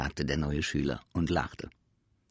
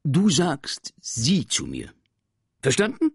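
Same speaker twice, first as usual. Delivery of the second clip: stern teacher; 8 semitones higher, 7 dB louder than usual. Acted. Teacher lectures pupil.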